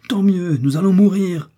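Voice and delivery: loud, high despairing shout; raging cry of someone doomed; desperate rage. A narrator's reading. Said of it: "s'écria Kennedy avec une rage désespérée".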